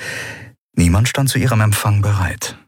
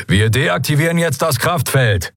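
higher-pitched, commanding announcement; official announcement; 7 semitones higher than usual. A narrator's reading. Commander to surrendered pirates, official announcement. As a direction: announce it to the prisoners with authority. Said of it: "kündigte der Kommandant an".

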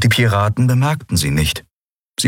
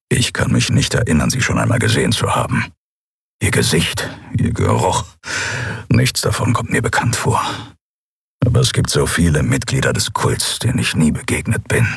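low, gruff character voice; pondering, low-pitched growly voice; Keru; 6 semitones lower than usual. A narrator's reading. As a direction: answer in a deep grumble.

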